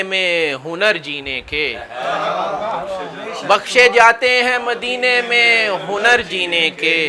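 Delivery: sustained declamatory Urdu verse; mushaira verse recitation. Acted. Poet reciting naat couplets to an audience, stately recitation. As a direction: let key words ring, settle on the refrain.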